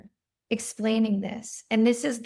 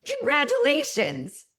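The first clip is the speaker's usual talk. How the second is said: higher-pitched mock cheer for good news; retold congratulation; 6 semitones higher than usual. Retired oncologist to his crying daughter, acted-out delighted congratulation. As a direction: imitate a delighted dad cheering.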